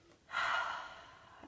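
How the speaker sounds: strong voiceless sigh out; audible exhale sigh. Shown as a demonstration. Guide breathes out the tension with listeners, audible sigh. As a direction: release the breath in one long sigh.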